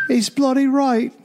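high, hammed-up English accent; terrible English accent. Host backing the co-host's tower bit, a bad high English accent. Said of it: "terrible English accents".

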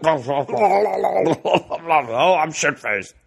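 put-on drunk voice. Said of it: "you'd be like".

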